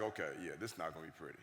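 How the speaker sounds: low, soft mock dread; resigned aside. Son foreseeing jokes at new school, resigned. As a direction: drop to a deflated murmur.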